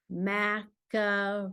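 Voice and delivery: "Macaroni" said slowly, one syllable at a time; slow syllable count. Shown as a demonstration. Teacher to child, slowly spelling out syllables by sound. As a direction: stretch "Macaroni" out so each syllable can be counted. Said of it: "How many syllables?".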